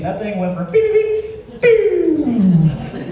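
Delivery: high imitated beeping, rising; machine beeping. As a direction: beep like a ghost detector going off.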